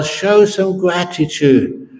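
a petitioner's slow, pointed reproach; demanding gratitude. Retold or imitated. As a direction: lean on every word to shame him.

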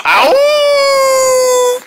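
long, very high "ow" wail; high howl. Acted. Friend left behind after Mars launch, high howl. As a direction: hold a piercing cartoon howl.

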